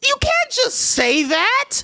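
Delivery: slow, very high-pitched protest; hammed-up shock, stretched out high; scandalized. Acts own shocked objection.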